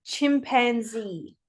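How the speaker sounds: CHIMPANZEE as one joined-up word; blended together. Shown as a demonstration. Pupil blending it all together for the teacher, joined-up. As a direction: run CHIMPANZEE together with no gaps between syllables. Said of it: "And all together?".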